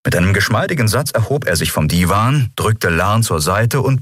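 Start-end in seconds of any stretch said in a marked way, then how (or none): none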